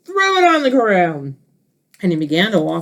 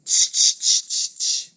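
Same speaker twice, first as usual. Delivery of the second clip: whispered tch tch, fading softer; monkeys tutting; 5 dB quieter than usual. Acted. Monkeys tutting back at the stamping peddler. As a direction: tut back like cheeky monkeys. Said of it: "and said".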